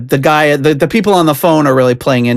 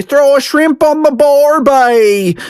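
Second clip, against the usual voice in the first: high, drawn-out mock Aussie; terrible Australian accent; 13 semitones higher, slower than usual. American teasing an Australian, terrible Australian accent. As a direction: ham up a bad Australian accent. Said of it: "with, like, a terrible accent".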